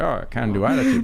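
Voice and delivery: mocking. Host mocking his own corny phrase, lightly sarcastic. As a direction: send up the phrase as hokey. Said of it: "which sounds like".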